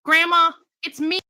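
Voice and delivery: calling out. Acted. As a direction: call into the house. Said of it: "she called out".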